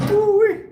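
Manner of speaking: loud high woo-wee mid-lift; straining whoop. Real, not acted. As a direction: holler through the strain of the rep. Shouts out during the set.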